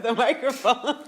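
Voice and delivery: laughing through the words. Real, not acted. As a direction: giggle it out. Teasing friend over the mic.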